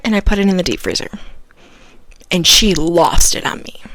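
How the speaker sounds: ordinary talk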